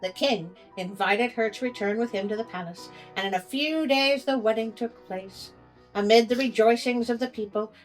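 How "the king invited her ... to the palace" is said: plain reading voice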